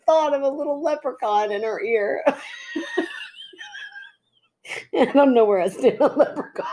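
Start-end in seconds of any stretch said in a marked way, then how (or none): 2.3-4.0 breathless high giggle fit; can't stop laughing, airy and high; helpless laughter. Real, not acted. Cracking up at leprechaun thought. 5.0-6.7 laughing. Real, not acted. Still laughing at the leprechaun.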